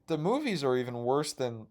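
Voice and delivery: ordinary delivery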